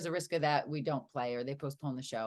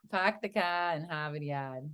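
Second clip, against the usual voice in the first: Boston accent. Shown as a demonstration. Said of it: "I love the Boston accent".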